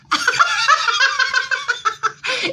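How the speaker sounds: loud high cackle; whooping laugh; big high laugh. Real, not acted. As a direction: burst out laughing. Laughs at own punchline.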